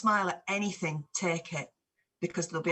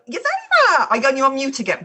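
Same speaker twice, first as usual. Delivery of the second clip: excited; 7 semitones higher, 10 dB louder than usual. Real, not acted. Greeting a guest who was muted.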